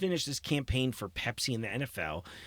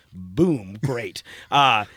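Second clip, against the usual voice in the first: normal talking voice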